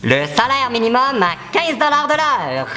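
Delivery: high squeaky helium voice; slow squeaky helium read. Real, not acted. Helium voice for promise.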